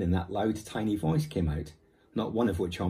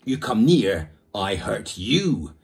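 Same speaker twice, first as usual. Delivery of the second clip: mild threat; 7 dB louder, slower than usual. Acted. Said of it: "said the Indian swiftly".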